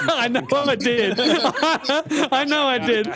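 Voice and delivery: words broken up by giggles; laughing. Real, not acted. Owning up to ruining a friend's joke, laughing. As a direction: talk through real laughter.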